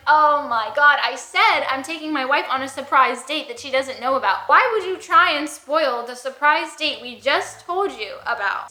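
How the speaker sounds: loud, exasperated retelling; mock exasperated husband. Husband complaining the stranger spoiled the surprise, loudly exasperated. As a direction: vent like a foiled husband.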